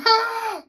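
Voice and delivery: high sudden mock yelp; retold sudden shriek; imitated outburst. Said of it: "She's like".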